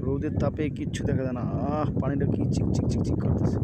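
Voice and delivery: shouting over wind. Real, not acted. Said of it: "বাতাসে কিছু শোনা যাচ্ছে না".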